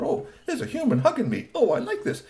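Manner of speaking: high, chuckling robot voice; a robot touched by a hug; delighted robot. A narrator's reading. Robot being hugged, delighted and giggly. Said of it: "He said".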